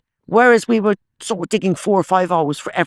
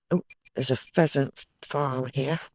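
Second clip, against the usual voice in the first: ordinary delivery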